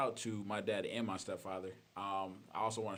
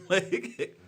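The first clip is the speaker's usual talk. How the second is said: laughing; 11 semitones higher, 13 dB louder than usual. Real, not acted. Laughs after honest admission.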